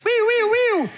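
high, loud wee-wee of an ambulance siren; siren imitation. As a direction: sing out a siren like a kid.

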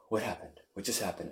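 soft, stunned, barely audible; dazed whisper-soft question. Retold or imitated. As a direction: ask it quietly, still shaken and lost. Dazed friend after crying fit.

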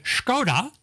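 Škoda opened with a sh sound, SHKOH-da; correct Czech pronunciation. Shown as a demonstration. Radio host reading out a pronunciation poll, careful Škoda with sh. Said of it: "You're supposed to say Škoda".

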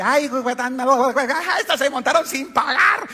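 high, laughing exclamation in a put-on voice; laughing mockery. Antioqueño laughing at the stowaways, high and mocking. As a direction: roar it through laughter. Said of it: "él empezó a reírse".

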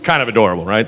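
ordinary delivery

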